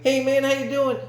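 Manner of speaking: high-pitched imitation of a boy saying hello; high mock kid voice; little kid. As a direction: mimic the kid's greeting up high. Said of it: "he was like".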